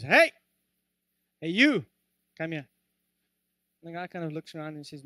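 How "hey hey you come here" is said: lightly acted parrot's loud hail; parrot calling out. Parrot in the pet shop hailing a customer, calling out. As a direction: call out sharply as a joke parrot. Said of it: "and the parrot says".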